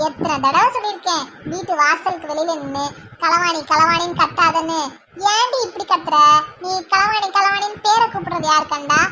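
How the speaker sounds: loud, indignant telling-off; exasperated scolding. Acted. Woman tells friend off for shouting her nickname, exasperated.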